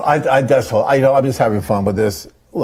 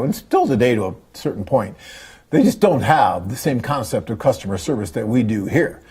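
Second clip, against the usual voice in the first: ordinary talk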